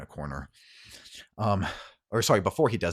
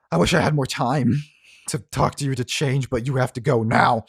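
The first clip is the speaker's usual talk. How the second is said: raised, louder, pressing voice; urgent plea; 5 semitones higher, 7 dB louder than usual. Acted. Othello sends Cersei away, urgent. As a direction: press them urgently to leave. Urgent parting under fire.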